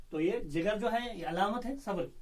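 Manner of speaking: normal talking voice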